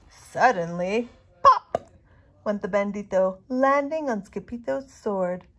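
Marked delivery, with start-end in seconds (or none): 1.4-1.9 sharp high popping sound; loud pop. A narrator's reading. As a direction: burst it out. Sound effect.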